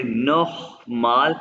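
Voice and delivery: नोखमाल drawn out slowly with a throaty ख; slow नोखमाल with a rasped ख; guttural kh. Shown as a demonstration. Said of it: "आपने खे की आवाज़ निकालना है".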